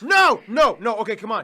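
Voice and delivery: loud anguished no; loud protest. Real, not acted. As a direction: beg them not to take it. Title revoked, protests.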